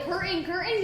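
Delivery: ordinary talk, nothing marked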